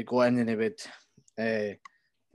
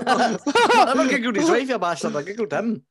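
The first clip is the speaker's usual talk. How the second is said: loud, slow, laughing denial; laughing protest; 12 semitones higher, 9 dB louder than usual. Real, not acted. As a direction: protest while laughing hard. Laughing protest at friend's teasing.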